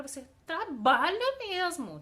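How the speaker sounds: loud, high swell on "trabalha"; emphatic intonation. Shown as a demonstration. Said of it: "a gente muitas vezes faz essa entonação".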